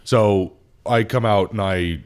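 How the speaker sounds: normal talking voice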